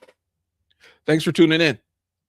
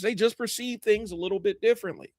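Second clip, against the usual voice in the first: usual speaking voice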